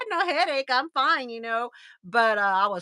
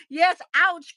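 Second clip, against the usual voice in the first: retold pained outcry; 6 semitones higher, 6 dB louder than usual. Retold pained answer to therapist.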